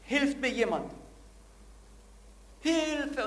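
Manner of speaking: loud, high, desperate cries for help; screaming for help. Acted. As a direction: yell for help like a man hanging from a cliff. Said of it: "und schreit".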